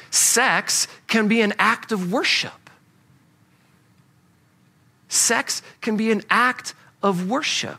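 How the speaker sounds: slow, weighted delivery of can; slow down and let can land; deliberate repeat. Shown as a demonstration. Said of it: "emphasize the word can".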